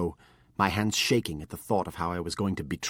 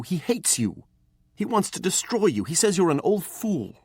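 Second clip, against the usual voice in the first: much higher, louder furious accusation; angry accusing boy; 9 semitones higher, 5 dB louder than usual. A narrator's reading. Apprentice accuses his master about Morgan, high and angry. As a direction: throw every charge at him.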